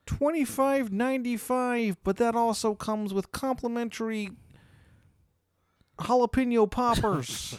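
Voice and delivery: high, slow price announcement; waiter voice. Acted.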